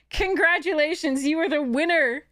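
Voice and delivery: bright high congratulations; excited announcement. Real, not acted. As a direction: cheer the winner on.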